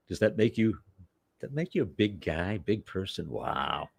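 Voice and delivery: soft, mock-impressed needling; sarcastic. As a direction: drop the volume for a dry put-down. Sarcastic retort to the imagined know-it-all.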